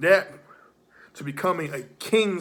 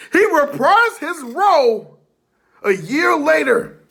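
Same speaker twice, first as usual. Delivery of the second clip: loud heated promo shout; wrestling promo; 8 semitones higher, 9 dB louder than usual. Acted. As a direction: build it up like a ring promo.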